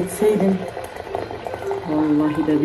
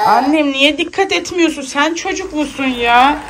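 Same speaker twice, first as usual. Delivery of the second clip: fond scolding; 8 semitones higher than usual. Real, not acted. Mother scolds the careless daughter.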